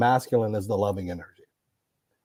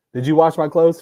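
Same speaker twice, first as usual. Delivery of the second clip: higher, louder mock complaint; mock demanding husband; 6 semitones higher, 6 dB louder than usual. Mocking a man quizzing his wife, hammed-up. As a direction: toss it off like a pampered husband.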